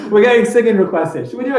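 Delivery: amused and giggly; laughing. Real, not acted. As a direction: talk fast through the tail of a laugh. Still laughing at requests.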